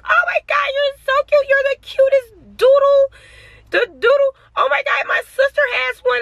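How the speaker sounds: very high-pitched imitation of a gushing admirer; mock gushing. Mimicking lady who petted the dog, high mock gushing. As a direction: squeal it as a mocking impression.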